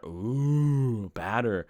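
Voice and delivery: cartoon glutton's long drooling ooh; mock food-craving moan; Homer Simpson. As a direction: draw out the ooh in dopey craving. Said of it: "I sound like Homer Simpson".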